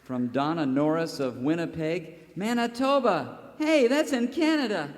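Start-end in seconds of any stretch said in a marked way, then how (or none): 2.4-4.9 higher, pleased discovery; hammed-up wonder; mock surprise. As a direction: act amazed it's in Canada.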